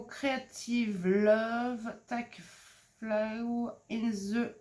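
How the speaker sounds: English words in a heavy French accent, drawn out; poor English accent. Real, not acted. French vlogger reading craft-die words aloud, poor English accent. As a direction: read the English slowly with a real French accent. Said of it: "mon accent anglais est déplorable".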